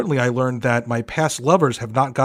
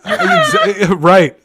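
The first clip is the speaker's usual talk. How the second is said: laughing; 6 semitones higher, 4 dB louder, much slower than usual. Real, not acted. Cracking up at joke.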